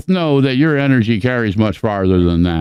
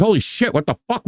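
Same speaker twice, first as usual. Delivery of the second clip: higher, mock shocked gasp of disbelief; stunned passerby; 11 semitones higher, 3 dB quieter, faster than usual. Stunned bystander after the goblin queen's flash, mock shock. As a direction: act like you just got flashed. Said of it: "And you're like".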